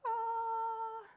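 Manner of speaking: high squeal of delight. Real, not acted. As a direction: let out a happy high ah. Delighted exclamation at squash.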